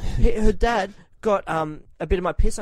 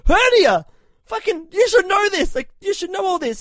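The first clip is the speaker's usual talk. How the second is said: high, loud drugged yelling at the surgeon; groggy angry yell; 13 semitones higher, 6 dB louder than usual. Retold or imitated. Patient under anaesthetic scolding the surgeon, high loud yell. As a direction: bark it up high, half-sedated and indignant.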